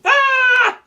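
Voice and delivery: high loud mock scream; cartoon scream. Poem's animals all screaming, high mock scream. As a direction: let out a big silly scream.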